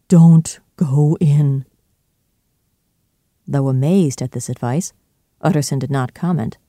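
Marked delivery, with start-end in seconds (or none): 0.1-1.6 very slow, forceful stage whisper; hushed warning. A narrator's reading. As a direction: whisper it urgently, drawing out each word. Butler's secret warning to Utterson.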